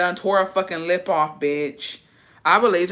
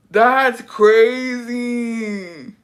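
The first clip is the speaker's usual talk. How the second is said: very slow, higher drawn-out that's crazy; slow amazed; stunned; 5 semitones higher, 4 dB louder, much slower than usual. Real, not acted. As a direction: stretch it in disbelief.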